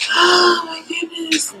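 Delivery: slow high surprised gasp; drawn-out high exclamation. Real, not acted. Sudden exclamation, slowed.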